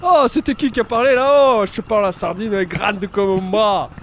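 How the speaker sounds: high, loud Marseille bragging; Marseille accent. Acted. Bragging to the AI about a sardine, loud Marseille accent. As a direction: brag in a hammed-up Marseille accent. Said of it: "Tu prends l'accent marseillais".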